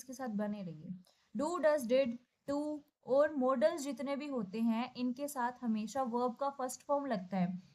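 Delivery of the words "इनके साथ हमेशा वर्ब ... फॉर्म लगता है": normal talking voice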